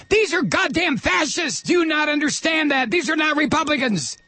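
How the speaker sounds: angry on-air shout; furious shout. Real, not acted. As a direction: roar it at the listeners like a rallying cry. Host ranting at Republicans.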